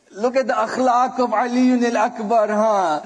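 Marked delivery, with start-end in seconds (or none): none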